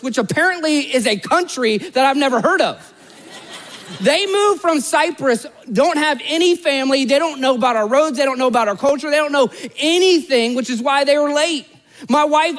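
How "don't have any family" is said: ordinary delivery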